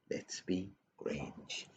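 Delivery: very soft and slow; hushed. Acted. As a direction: drop it to a near whisper. Final chant dropped low.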